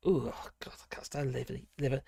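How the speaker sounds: hushed, revolted aside; disgusted whisper. Real, not acted. Streamer recoiling from liver talk, whispered disgust. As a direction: whisper it like the thought makes you queasy.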